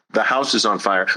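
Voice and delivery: normal talking voice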